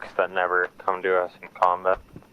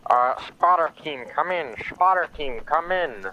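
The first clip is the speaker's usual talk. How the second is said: raised, clipped radio call; radio operator voice; 7 semitones higher, slower than usual. Retold or imitated. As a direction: call over the radio, pitched up. Radio call voiced in retold story.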